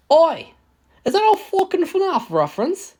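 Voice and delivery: high, loud mock British shout; British accent. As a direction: squawk it out in a cheeky British accent. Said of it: "Oh, wait, that's a British accent".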